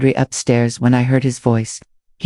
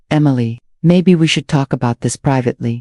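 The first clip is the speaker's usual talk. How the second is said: usual speaking voice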